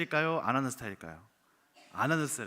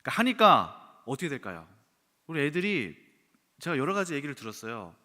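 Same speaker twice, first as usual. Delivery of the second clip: ordinary talk, nothing marked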